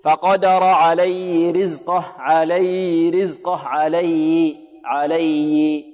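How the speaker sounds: عليه said slowly with the ha swallowed; mock student error. Shown as a demonstration. Teacher imitates a student who drops the ha sound. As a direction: slur the ha in عليه. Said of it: "لا يأتي بها واضحة هذه الهاء".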